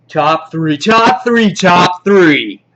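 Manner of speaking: loud, high, repeated segment call; hyped countdown announcer. Pretend.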